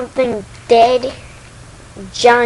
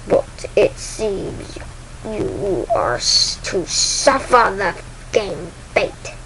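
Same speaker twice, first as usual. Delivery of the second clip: very slow, halting villain voice; Darth Vader; much slower than usual. Acted. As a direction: pronounce doom word by word. Vader continues to condemn clone.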